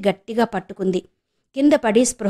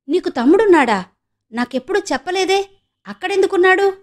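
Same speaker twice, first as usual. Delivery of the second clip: surprised; 9 semitones higher than usual. Acted. Ramu learns of a brother.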